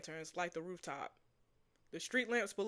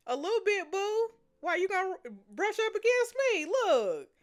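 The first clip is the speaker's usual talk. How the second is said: much higher and louder, playful; giddy flirting; 11 semitones higher, 8 dB louder than usual. Real, not acted. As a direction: tease him in a high giddy voice. Flirty teasing reply to Cody.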